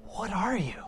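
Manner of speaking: soft acted disbelief; awed thug. Thug looks at Batman, hushed astonished question. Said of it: "guy looks at him and he says".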